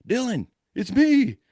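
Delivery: high, slow call to someone far away; drawn-out distant call. Retold or imitated. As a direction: holler it across the woods. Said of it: "he's yelling".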